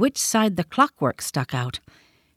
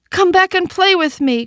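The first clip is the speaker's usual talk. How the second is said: much higher, louder call after them; 11 semitones higher, 5 dB louder than usual. A narrator's reading. Toy rabbit calling after the fleeing wild rabbits, loud and high. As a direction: call out to friends running away. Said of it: "called the little rabbit".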